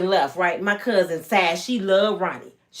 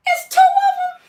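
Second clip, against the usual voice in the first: high, laughing shriek of disbelief; shocked shriek; 6 dB louder than usual. Retold or imitated. The girl spotting Ecstasy's twin, laughing and shrieking in shock. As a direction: squeal at the double shock.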